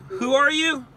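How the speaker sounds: high mock squeak on "Who are you?"; mock puzzled question. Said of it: "Yesterday, you're like".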